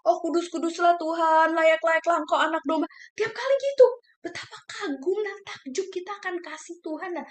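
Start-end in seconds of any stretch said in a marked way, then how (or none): 0.1-2.9 loud worship cry. Retold or imitated. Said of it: "Aduh, Tuhan begitu ya". 3.1-3.9 high and loud, carried away; fervent preacher cry; loud high exclamation. Real, not acted. Preaching climax.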